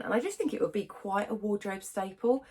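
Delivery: ordinary delivery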